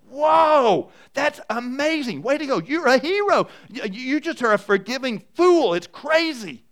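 high, loud, overblown praise; mock gushing cheer. Disciples cheering Peter's boast, hammed-up high gushing. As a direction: gush over him like an amazed fan.

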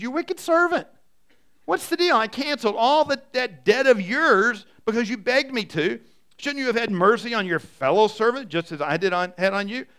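high, loud rebuke; angry master. Acted. Master rebuking the wicked servant, high and loud in anger.

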